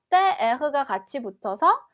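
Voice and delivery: ordinary delivery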